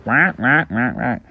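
short, fading duck quacks; quacking ducks. Acted. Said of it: "And they're like".

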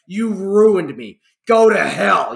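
acted-out furious yell; mock screaming rant. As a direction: hurl it like a ruined man.

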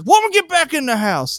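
high, loud mock bellow; angry yelling. Husband ordering his wife inside, high angry yell.

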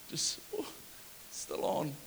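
hushed breathy ooh, almost silent; mock scared hush. Preacher imagining Nehemiah's fear, hushed mock dread. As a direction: shrink away in mock fear.

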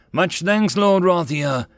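a frightened survivor, voice trembling; a mild tremble of gratitude and fear. A narrator's reading. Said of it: "a trembling voice spoke".

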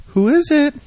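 high-pitched singsong call; silly high voice answering a knock; mock door answerer. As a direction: call sweetly through the door.

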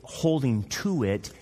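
ordinary talk, nothing marked

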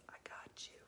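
unvoiced conspiratorial whisper; whispered reassurance. Elderly guard whispering reassurance to the comedian, hushed. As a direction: breathe it like a secret promise.

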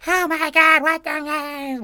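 put-on high, loud shrill shriek of an old lady; shrieking old woman. Neighbor shrieking at the water balloon hit. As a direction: screech like a furious old lady. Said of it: "losing her damn mind".

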